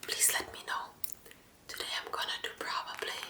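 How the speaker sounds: hushed, voiceless whisper to viewers; ASMR whisper. Acted. ASMR host asking viewers which they prefer, whispering. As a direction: whisper it close to the mic.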